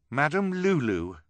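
slow, loud, high firm address; firmly. Acted. Violet firmly confronting the crying woman. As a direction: speak up firmly but unsure. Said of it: "Violet said firmly".

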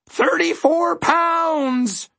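very high, drawn-out squawking shriek; bird shriek. A narrator's reading. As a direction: shriek it slowly like a departing bird. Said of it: "shrieked the bird".

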